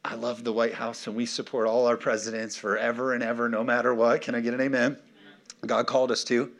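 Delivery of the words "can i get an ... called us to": ordinary talk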